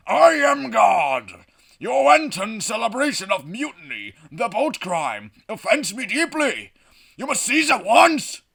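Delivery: high, loud, wrathful proclamation; God, terrifying and booming. Acted. God orders the mutiny celebration to cease, booming. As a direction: thunder down at the sinners. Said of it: "a terrifying voice booms from the sky".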